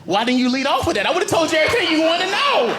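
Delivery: high, keen voice spilling everything; eager confession. Acted. Suspect happily confessing to the cops, high and eager. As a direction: blurt it all out gladly. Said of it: "And I'm like".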